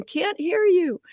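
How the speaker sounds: high-pitched mock holler; high singsong shout, re-enacted; imitating distant caller. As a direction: call back cluelessly from far across the water. Husband calling back across the water.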